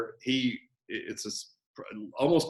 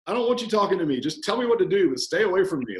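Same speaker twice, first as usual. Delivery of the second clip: high, mock-irritated brush-off; hammed-up grumbling client, pitched up; client's banter; 6 semitones higher, 4 dB louder, faster than usual. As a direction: playfully wave the coach away. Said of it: "a little bit of banter between the coach and client".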